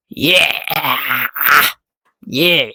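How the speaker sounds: short, drawn-out "yeah" yell; sudden yell. Real, not acted. Speaker suddenly yells during speech practice, abrupt yell. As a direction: let out an abrupt, mild yell. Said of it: "Kenapa tiba-tiba teriak?".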